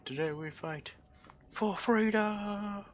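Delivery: rising to a huge, drawn-out shout; roaring rallying speech. Pretend. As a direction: build from a call to a slow roar of "freedom". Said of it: "They'd be like".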